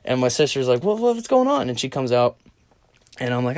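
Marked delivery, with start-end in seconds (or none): 0.8-1.7 high-pitched startled questioning; mock alarm in a high voice; imitating alarmed sister.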